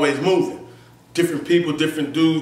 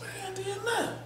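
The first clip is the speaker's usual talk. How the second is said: much higher and softer, protesting innocence; hushed denial; 14 semitones higher, 14 dB quieter than usual. Retold or imitated. Telling his friend he did nothing, hushed high protest. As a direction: squeak out the denial under your breath.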